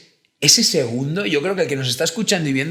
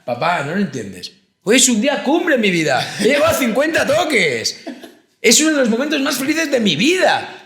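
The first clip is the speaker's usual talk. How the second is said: put-on high, loud, gushing boy with a laugh; excited kid; 9 semitones higher, 4 dB louder than usual. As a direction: play the overjoyed kid telling dad his big news, much higher. Kid telling father proudly.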